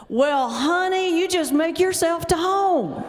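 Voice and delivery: high, slow, exaggerated Southern drawl; thick Southern accent. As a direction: stretch it out in a broad, hammed-up Southern accent. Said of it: "in a thick Southern accent say".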